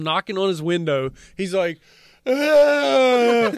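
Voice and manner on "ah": startled shout, high; startled yell. Retold or imitated. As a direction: jolt awake with a loud cry. Startled driver waking.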